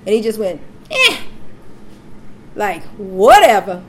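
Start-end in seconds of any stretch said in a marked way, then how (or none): 0.9-1.2 short imitated baby "eh"; abrupt, unimpressed grunt, mimicking a baby; grumpy newborn grunt. Mother imitating her scowling newborn, grumpy grunt. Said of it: "He just got this mean look on his face". 3.0-3.8 loud, sassy dismissal; mock dismissive newborn. Mother voicing her unimpressed newborn, loud mock dismissal. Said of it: "He just got this mean look on his face".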